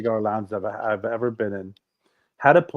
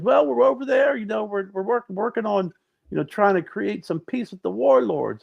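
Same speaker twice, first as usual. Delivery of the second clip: higher-pitched, lightly voiced Clinton; Bill Clinton impression; 9 semitones higher than usual. Comedian as Clinton telling McDonald's staff about his run, higher mock voice. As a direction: mimic Clinton's breezy jogger chatter, raised pitch. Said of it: "as Bill Clinton".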